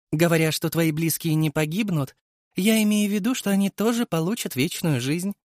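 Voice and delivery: high, mocking creature voice; sneering worm king voice. A narrator's reading. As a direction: voice an amused parasite villain. Villain explains.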